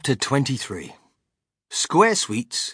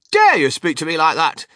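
loud, raised, affronted exclamation; outraged shout; 4 semitones higher, 9 dB louder than usual. A narrator's reading. Missus Salt affronted by Wonka's insult, shouting. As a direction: shout it, deeply offended. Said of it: "shouted Missus Salt".

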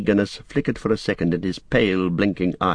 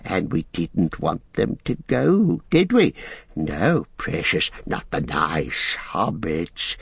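Gollum whispering; much slower than usual. Acted. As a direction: draw out each dreaded word, hissing the ends. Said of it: "he whispered".

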